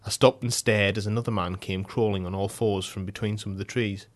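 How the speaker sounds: ordinary delivery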